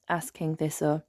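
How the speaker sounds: plain reading voice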